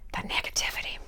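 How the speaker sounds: breathy whispered aside. Real, not acted. Whispering to viewers to cut the negativity. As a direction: drop to a whisper on the word. Fading aside after no energy.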